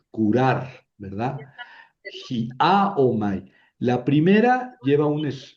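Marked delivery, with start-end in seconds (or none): 2.3-3.5 "Hiaomai" as hi, á, o-mai; stress on the alpha. Shown as a demonstration. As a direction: split off the hi and lean on the a in "Hiaomai". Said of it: "Si pongo el acento en la alfa".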